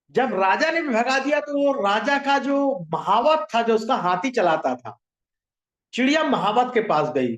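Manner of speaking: usual speaking voice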